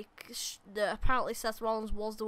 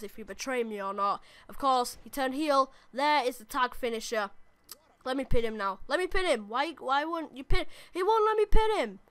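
excited wrestling commentary, higher and louder; live play-by-play; 4 semitones higher, 5 dB louder than usual. Acted. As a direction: call every move as it happens. Live commentary on his own match.

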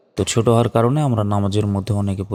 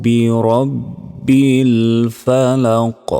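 loud, very slow tajweed recitation; resonant, sustained teaching recitation; Quran recitation; 3 semitones higher, 5 dB louder, much slower than usual. Shown as a demonstration. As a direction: recite slowly with long drawn-out vowels.

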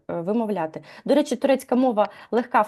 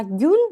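"гюн" given a firm stress; stressed syllable; 5 semitones higher, 5 dB louder than usual. Shown as a demonstration. Teacher showing where stress falls, "гюн" stressed. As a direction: lean on "гюн" as the first accented part. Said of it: "да, падає наголос".

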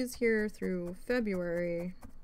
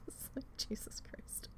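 whisper; 6 semitones higher, 11 dB quieter than usual. Real, not acted. Exasperated aside after laughing.

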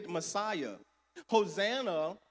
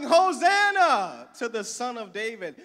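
loud, high jubilant cry; louder, ringing Hosanna; crowd shout; 4 semitones higher, 8 dB louder than usual. Retold or imitated. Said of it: "They were shouting".

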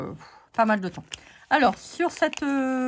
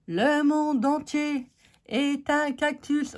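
higher, slow, grandly declaimed; mock proclamation; 5 semitones higher, slower than usual. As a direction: pronounce it like a mock-solemn proverb. Mock-grand proverb on cactus ink.

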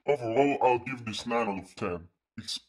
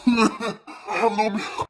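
laughing; 10 semitones higher, 9 dB louder, slower than usual. Real, not acted. Laughing while mouth burns.

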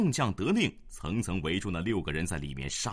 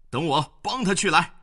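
high, loud call to action; eager shout; 9 semitones higher, 9 dB louder than usual. A narrator's reading. General vowing to go help his comrade, loud and eager. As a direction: shout it as you spur off.

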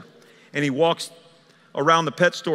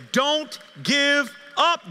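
high, very slow, stretched words; sustained, high-pitched preacher's plea; drawn-out exhortation; 10 semitones higher, much slower than usual. Acted. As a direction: call it out word by word. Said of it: "Joseph would say".

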